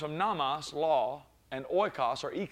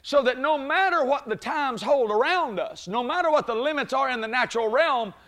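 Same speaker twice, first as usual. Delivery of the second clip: preacher rising to a shouting peak; preaching at full force; 10 semitones higher, 9 dB louder than usual. Acted. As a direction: lift the church with each phrase. Preaching climax with congregation answering.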